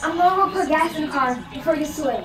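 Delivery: high, loud call across house; calling out to mom. Acted. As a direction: shout it out as you leave.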